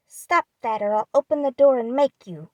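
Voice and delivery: loud threatening shout through a door; threatening call. Acted. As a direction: warn her off, loud and menacing. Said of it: "called out the young gentleman in a threatening tone".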